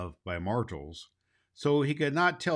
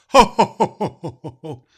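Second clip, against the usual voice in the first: big jolly Santa laugh, loud at the start; jolly booming ho ho ho; hearty ho ho ho; 6 semitones higher, 12 dB louder than usual. Acted. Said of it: "Claus laughed".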